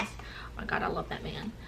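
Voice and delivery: hushed, whispered affection; quiet, breath-only aside; tender aside. Real, not acted. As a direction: drop to a soft whisper for someone dearly missed. Aside about late manager.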